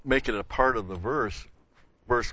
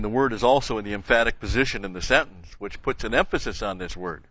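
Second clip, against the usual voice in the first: ordinary talk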